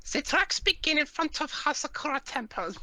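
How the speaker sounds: put-on Von Karma, German accent. Player voices Von Karma in a game read-aloud, high German accent. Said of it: "I can do my Von Karma voice".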